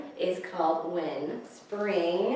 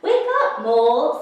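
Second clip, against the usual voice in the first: Bear waking Mole; 3 semitones higher, 11 dB louder than usual. A narrator's reading. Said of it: "said Bear".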